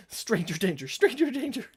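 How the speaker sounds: high, loud horse-in-a-panic cry; hammed-up retold scream; mock scream. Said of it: "back off while screaming".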